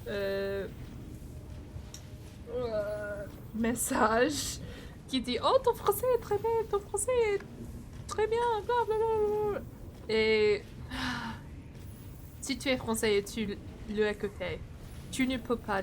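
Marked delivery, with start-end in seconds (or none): 5.4-9.6 high, fast gushing fan voice; squealing mock praise, rattled off. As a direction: gush like an overeager fan, pitched way up. Mocking viewers' praise messages.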